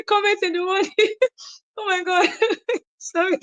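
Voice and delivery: high voice breaking up with laughter; laughing. Real, not acted. Speaker apologizing over the morning comments, laughing. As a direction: try to talk through real giggles.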